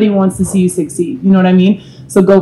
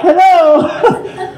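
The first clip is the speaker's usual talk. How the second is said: high, drawn-out mock French accent; badly faked French hello, stretched out high; 11 semitones higher than usual. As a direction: put on a silly French accent. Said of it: "That was my French accent".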